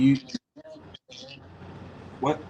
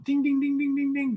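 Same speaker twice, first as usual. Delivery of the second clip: high sung bell dings; mock bell ding; 14 semitones higher, 4 dB quieter than usual. Shown as a demonstration. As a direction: chime like a quiz-show bell. Bell sound for a winner.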